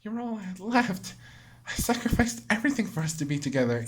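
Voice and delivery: lightly voiced heartbroken girl; hurt Monika. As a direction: plead through the hurt.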